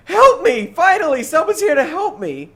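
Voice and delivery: put-on very high, loud little-girl cry for help; little girl pleading. Gamer voicing a girl in a cave to his friends, high loud pleading. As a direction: beg like a trapped child. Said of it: "she goes".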